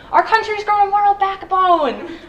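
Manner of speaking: mock rallying cheer. Comic host asking the crowd to cheer bare minimum decency, mock-triumphant. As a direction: whip up applause, climbing to a yelled last word.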